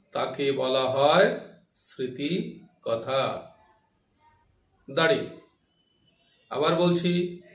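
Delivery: slow dictation with pauses between phrases; dictating. Real, not acted. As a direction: read it out phrase by phrase for notes. Teacher dictates answer to class.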